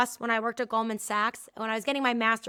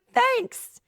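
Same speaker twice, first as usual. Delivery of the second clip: much higher, drawn-out mock thanks; sarcastic thanks; 11 semitones higher, 4 dB louder than usual. Speaker answering a client's backhanded compliment, sarcastically. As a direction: thank her with wry irony.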